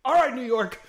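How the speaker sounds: high, loud, through a laugh; laughing resignation. Retold or imitated. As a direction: shrug it off laughing. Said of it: "it was like".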